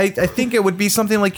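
ordinary delivery

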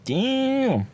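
high, drawn-out impressed exclamation; impressed damn. Real, not acted. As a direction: sound genuinely wowed. Reacts to fifteen matches.

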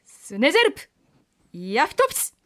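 スネゼルプ イヤフィトプス as reversed syllables, final ス breath only; backwards speech. Shown as a demonstration. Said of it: "掛け合いながら言ってください".

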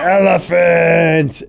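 drawn-out shouted elephant; big elephant shout. A narrator's reading. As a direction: announce the elephant big and slow. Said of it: "it was a big".

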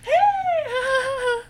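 high, laughing, nervous yelp; nervous squeak. Retold or imitated. Fan greeting the mascot later, nervous squeak. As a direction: squeak and shy away. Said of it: "she'd just be like".

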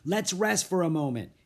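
high-pitched call from a distance. A narrator's reading. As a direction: call over to a friend. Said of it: "Annie called".

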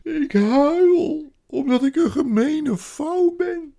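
loud, slow, very high bawl; hammed-up tearful howl; heartbroken wailing. A narrator's reading. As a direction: wail it out like a weeping faun. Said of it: "de faun bleef hartverscheurend snikken".

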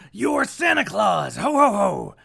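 loud, slow Santa shout; booming Santa. Acted. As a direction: boom it out like Santa.

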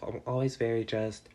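ordinary talk, nothing marked

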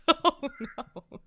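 high, loud laughing dismay; high, laughing, a touch of panic. Retold or imitated. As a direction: laugh it out as the edible hits. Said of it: "I was like".

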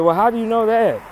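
high loud incredulous question; shrill doubting voice; skeptical questioner. Retold or imitated. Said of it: "They said".

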